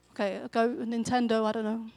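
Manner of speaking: usual speaking voice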